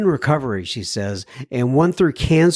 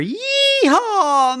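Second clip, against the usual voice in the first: much higher whooping yeehaw; excited cheer. Real, not acted. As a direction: whoop for the milestone. Cheers a listener's seven sober years.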